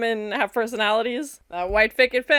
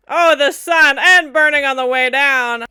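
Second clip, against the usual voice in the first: loud, higher-pitched reaction; excited outburst; 5 semitones higher, 9 dB louder than usual. Real, not acted. Viewer reacting as someone burns falling in the sun, excited outburst. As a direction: blurt it out loudly at the shock.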